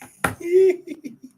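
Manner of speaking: a high burst of laughter after a taunt. Real, not acted.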